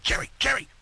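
high-pitched imitated call of his name; calling out sharply. Grandmother calls her grandson to stop acting up, high sharp call. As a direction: mimic grandma yanking him back by name.

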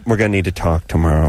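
low, fast, ominous hint; ominous tease. Acted. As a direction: drop the bad news lightly. Teasing Drew with the ominous line.

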